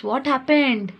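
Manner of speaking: usual speaking voice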